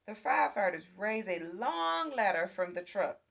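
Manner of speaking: ordinary delivery, nothing marked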